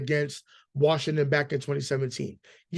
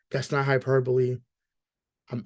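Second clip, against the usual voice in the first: ordinary talk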